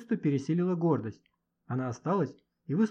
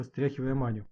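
plain reading voice

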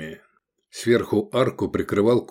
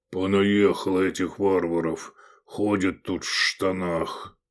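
grumbling. A narrator's reading. Cook returning to kitchen, grumbling about foreigners. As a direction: grumble it to yourself. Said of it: "бурча".

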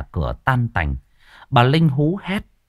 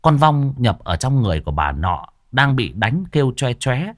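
ordinary delivery

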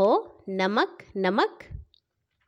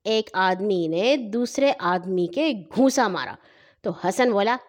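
usual speaking voice

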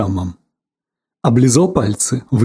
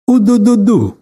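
playful gunfire noise, higher; rat-a-tat like a toy gun; machine gun noise; 6 semitones higher, 3 dB louder than usual. Acted. Boy imitates machine gun. (детская имитация очереди)

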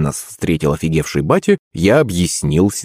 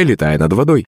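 ordinary delivery, nothing marked

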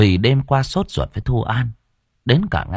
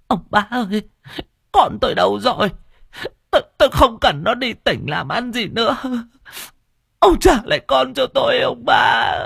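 much higher, tearful wailing plea; crying; 14 semitones higher than usual. Acted. Old mother begging the master for her daughter back, wailing much higher. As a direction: beg through tears, rising to a cry. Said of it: "Bà cụ bật khóc".

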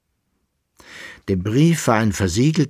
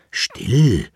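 ordinary delivery, nothing marked

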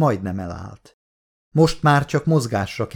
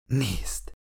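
hushed, much softer single word; whispered; 8 dB quieter than usual. A narrator's reading. Woman urges others to look, whispered. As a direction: say it under your breath. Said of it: "suttogta a lány".

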